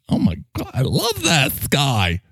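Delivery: normal talking voice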